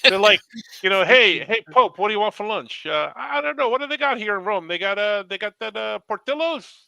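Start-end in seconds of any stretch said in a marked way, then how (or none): 1.1-6.9 higher-pitched Chicago local greeting the Pope; lively mock-Chicagoan chatter; Chicago accent. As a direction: play an eager Chicago guy, pitched up. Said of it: "go right back to a Chicago accent".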